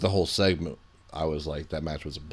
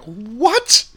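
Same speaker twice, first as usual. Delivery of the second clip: surprised what; 12 semitones higher, 5 dB louder than usual. Retold or imitated. Retells seeing the glass shatter.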